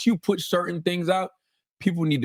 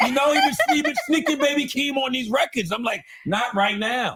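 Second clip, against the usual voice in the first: excited; 9 semitones higher than usual. Real, not acted. Excited rant about [name].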